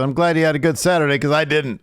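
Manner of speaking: quick, high complaint; mock heckling wrestler. Wrestler griping at the crowd, high mock complaint.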